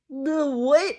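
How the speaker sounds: ordinary talk, nothing marked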